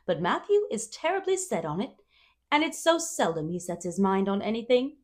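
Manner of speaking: plain reading voice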